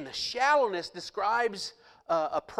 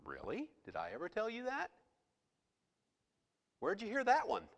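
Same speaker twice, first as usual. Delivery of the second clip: gentle voice of Jesus; 4 semitones higher, 6 dB quieter than usual. Retold or imitated. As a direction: gently ask where they heard that. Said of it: "he would say".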